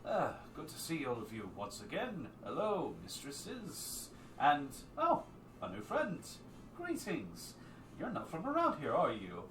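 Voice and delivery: courtly greeting, pitch swooping up high; Sir Esper. Acted. Sir Esper greeting his guests and a new friend, lilting. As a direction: greet them with lilting, theatrical surprise. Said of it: "You hear Sir Esper".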